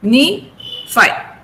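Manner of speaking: sign, ni, fy split into separate beats; syllable by syllable. Shown as a demonstration. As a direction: chop the word into three beats. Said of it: "there are three syllables".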